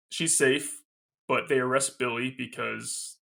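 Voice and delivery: normal talking voice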